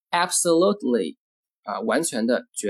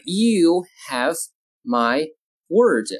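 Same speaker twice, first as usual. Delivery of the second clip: slow, with a gap between words; word-by-word model; 3 semitones higher, much slower than usual. Shown as a demonstration. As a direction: dictate it calmly and slowly. Teacher models sentence word by word.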